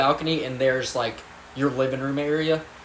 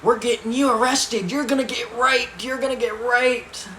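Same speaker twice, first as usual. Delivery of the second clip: higher, mock yelling; shrill taunt of angry adults; mock screaming adults; 10 semitones higher, 4 dB louder than usual. Adults threatening the caught kid, higher mock yell. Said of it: "they were screaming".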